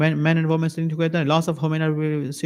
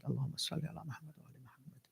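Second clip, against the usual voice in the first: hushed, rapid salawat, barely audible; private murmured recitation; 22 dB quieter, much faster than usual. Shown as a demonstration. Preacher shows how to recite without showing others, murmured. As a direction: recite very softly and quickly under your breath. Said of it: "Don't show others".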